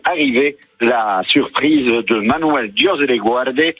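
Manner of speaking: ordinary talk, nothing marked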